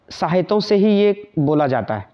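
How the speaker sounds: usual speaking voice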